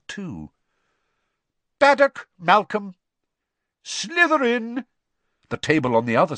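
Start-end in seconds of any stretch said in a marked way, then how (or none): 1.8-2.9 loud, high roll call; calling out names. A narrator's reading. Teacher calls out name. 3.8-4.8 high, drawn-out announcement; hat's proclamation. A narrator's reading. Hat proclaims house.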